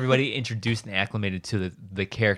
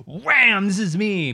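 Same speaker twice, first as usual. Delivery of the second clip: high, loud burst of self-announcement; brash character entrance; 9 semitones higher, 5 dB louder, slower than usual. Acted. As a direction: burst in as an over-the-top rookie character. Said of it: "they come out and they're just like".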